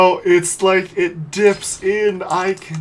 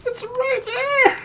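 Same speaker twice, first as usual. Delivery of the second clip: very high, drawn-out whine; agonised whine. Real, not acted. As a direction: wail slowly over a near miss. Agonising over near miss.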